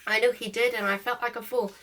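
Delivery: ordinary delivery, nothing marked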